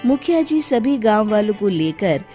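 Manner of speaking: normal talking voice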